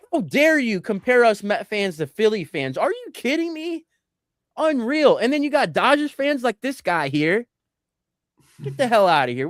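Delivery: high, indignant, hammed-up rant; mock outrage. Real, not acted. Mets fan protesting the comparison to Philly fans, mock outrage. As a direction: act scandalised at the insult.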